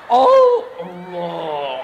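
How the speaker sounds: loud, hugely drawn-out mock takbir; disruptive takbir. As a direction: stretch it out low and loud, mocking a showy worshipper. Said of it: "nak ganggu memang maki".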